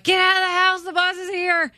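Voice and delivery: high, urgent call through the house; calling out urgently. Retold or imitated. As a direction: hurry the kid out the door. Mom calling kid to bus.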